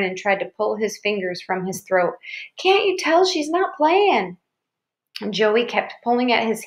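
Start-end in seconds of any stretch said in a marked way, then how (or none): 2.6-4.4 put-on high, drawn-out child's appeal; alarmed little girl; frantic pleading. A narrator's reading. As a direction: implore him slowly in a high voice. Frightened sister begs brother to run.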